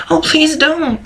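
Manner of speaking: high, frightened begging; a shy pony's high panicked please. A narrator's reading. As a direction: plead high and scared. Fluttershy pleading in panic.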